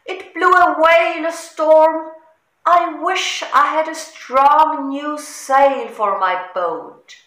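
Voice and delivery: a bleating, woeful goat; upset goat. A narrator's reading. Goat telling George about the lost sail, plaintive.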